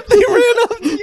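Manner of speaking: high, loud question through laughter; laughing incredulously. Real, not acted. Host asks friend about being caught on foot, laughing incredulously. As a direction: ask it while cracking up.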